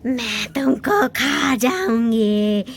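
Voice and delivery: slow low threatening witch. Acted. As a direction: menace the children slowly. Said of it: "काली भयानक चुड़ैल".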